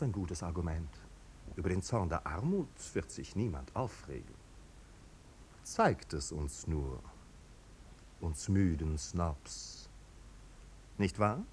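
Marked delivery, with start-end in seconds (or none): 5.6-9.9 slow sneer. Acted. Marquis sneers at poverty.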